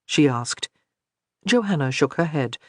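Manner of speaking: ordinary delivery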